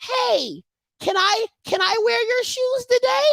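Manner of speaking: high, tentative pleading; little brother asking. Acted. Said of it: "the little brother is like".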